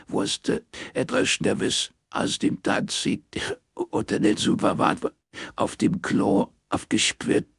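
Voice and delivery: distraught weeping, pitch leaping high; barely intelligible sobbing. A narrator's reading. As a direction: choke the words out through sobs. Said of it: "Sie war kaum zu verstehen".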